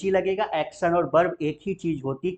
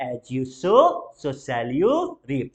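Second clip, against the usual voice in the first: slow, drawn-out reap for repeating; slow repeat-after-me; 3 semitones lower, much slower than usual. Shown as a demonstration. Teacher pauses for class to repeat, very slow. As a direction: spell it out slowly for learners. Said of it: "बोलेंगे जरा".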